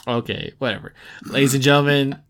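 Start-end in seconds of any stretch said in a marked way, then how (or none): 1.2-2.2 a louder, slow showman announcement; announcing the new Name That Game segment, slow and grand. Acted. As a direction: drag out the game-show host intro.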